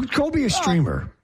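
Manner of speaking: half-laughing taunt; mocking jab.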